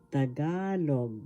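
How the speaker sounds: "Tagalog" said slowly with a long GA; pronunciation demo. Shown as a demonstration. Teacher showing students how it is pronounced, slow "Tagalog" with long GA. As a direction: draw out the middle syllable of "Tagalog". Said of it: "This is how it is pronounced".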